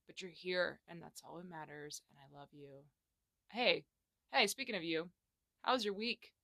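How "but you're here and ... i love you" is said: a quiet, softer I love you; tender and soft. Real, not acted. Host softly tells her listeners she loves them. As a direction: say it softly, close to the mic.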